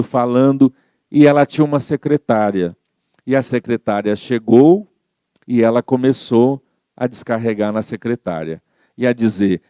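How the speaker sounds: normal talking voice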